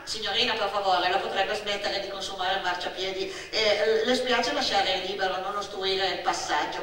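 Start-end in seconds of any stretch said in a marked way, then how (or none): none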